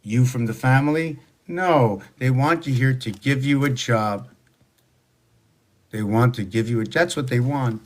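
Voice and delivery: ordinary delivery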